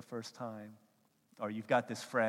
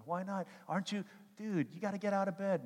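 higher, lightly hammed pestering; pushy friend; 9 semitones higher than usual. Friend urging dumped guy to get up, mock pushy. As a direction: nag a buddy out of bed.

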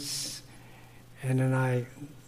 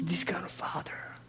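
whisper; 15 dB quieter than usual. Retold or imitated. Kids' question retold, whispered.

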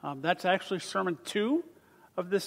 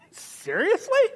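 high, laughing disbelief; incredulous laugh; 5 dB louder, slower than usual. Real, not acted. Preacher mocking Jonah's claim, laughing and incredulous.

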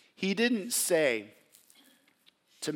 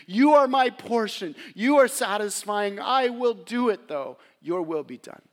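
loud, higher pleading prayer; anguished plea; 6 semitones higher, 7 dB louder than usual. Acted. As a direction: cry it out, then surrender at the end. Gethsemane prayer, voiced.

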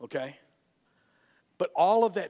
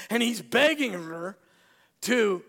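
ordinary talk, nothing marked

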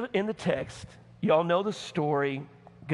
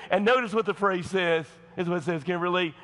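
ordinary delivery

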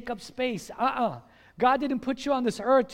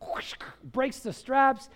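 ordinary talk, nothing marked

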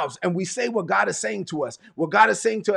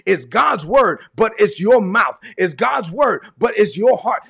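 louder preaching refrain; full-force preaching; 5 dB louder, slower than usual. Acted. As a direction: hammer home each line of the refrain.

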